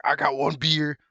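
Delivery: a light mock Stone Cold, second line slowed; rivals' host mocking Stone Cold's beer shtick in a put-on voice. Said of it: "He doesn't need to be like".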